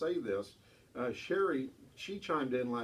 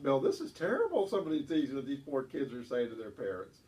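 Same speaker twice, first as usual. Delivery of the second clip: usual speaking voice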